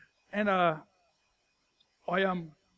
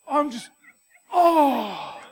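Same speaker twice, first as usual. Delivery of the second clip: high, loud laughing panic; panicked laugh at own blunder; 7 semitones higher, 9 dB louder, much slower than usual. Real, not acted. Panic at forgotten son.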